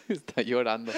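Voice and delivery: giggling through the words; trying not to laugh. Real, not acted. Laughing while speaking.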